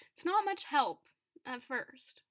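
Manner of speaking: usual speaking voice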